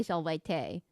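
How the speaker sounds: "Solway te" said sol-way tay; Latin V as W. Shown as a demonstration. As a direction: Latin plural greeting "Solway te". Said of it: "you say".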